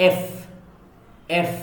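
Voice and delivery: touch lip to teeth for the F in এফ. Shown as a demonstration. Teacher drilling the letter F, teeth on lip. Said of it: "touch your upper teeth with your lower lip".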